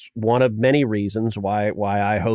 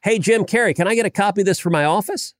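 playful sarcasm; 9 semitones higher than usual. Retold or imitated. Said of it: "I tweeted the picture out and I said".

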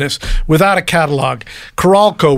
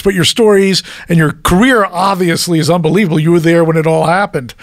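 ordinary delivery

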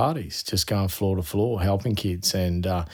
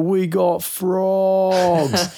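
high, very slow "We got frogs" stretched out; drawn-out excited cry; 11 semitones higher, 4 dB louder, much slower than usual. Retold or imitated. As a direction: crow it to the whole party. Teen shouting about frogs.

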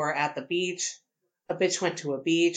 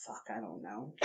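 normal talking voice

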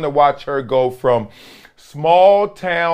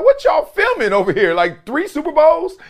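rising, higher incredulous question; mock disbelief; 13 semitones higher than usual. Husband marvels at the film crew, incredulous mock question. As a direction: act floored by all the cameras. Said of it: "I'm like".

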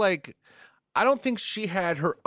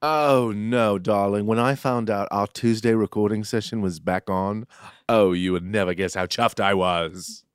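lower, languid mock-English voice; put-on English accent; 4 semitones lower than usual. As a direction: gush in a theatrical English accent. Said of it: "In an accent".

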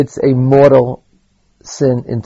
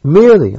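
"Merely" alone, high and loud; emphatic, high-pitched "Merely"; stress on merely; 5 semitones higher, 6 dB louder than usual. Real, not acted. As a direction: punch "Merely" as a word by itself. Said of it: "I stress the word merely".

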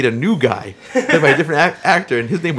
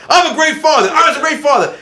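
higher, louder indignant protest; mock indignant dad; 10 semitones higher, 4 dB louder than usual. Dad insisting to son he was a great father, mock indignant. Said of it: "he's running around the store telling".

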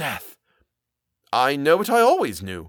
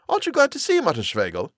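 high, quick, excited voice; high-pitched, hurried asking; eager question; 7 semitones higher, faster than usual. A narrator's reading. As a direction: press a friend with bright surprise. Puzzled, eager question to friend.

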